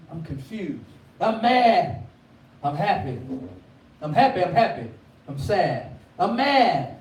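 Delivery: swinging between laughing joy and mad outbursts; emotional roller coaster. Pretend. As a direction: act out moods flipping up and down. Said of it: "emotional roller coaster".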